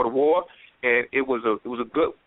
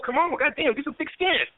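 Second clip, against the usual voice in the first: higher, mock scolding; teasing scold; 10 semitones higher than usual. Comedian teases radio host to toughen up.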